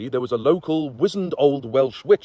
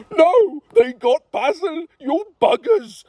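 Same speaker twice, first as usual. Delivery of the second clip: much higher and louder, slow cry; anguished cry; 4 dB louder, slower than usual. Acted. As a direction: cry out for a fallen friend. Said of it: "cried Lord Dwayne".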